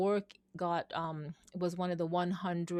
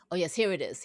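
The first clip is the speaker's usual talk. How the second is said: normal talking voice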